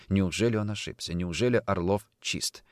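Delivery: usual speaking voice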